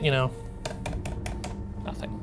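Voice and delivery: ordinary delivery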